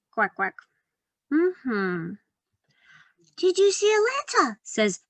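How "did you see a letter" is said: little mouse voice. Acted. Mouse character Penny asks.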